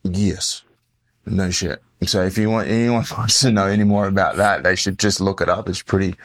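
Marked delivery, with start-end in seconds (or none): none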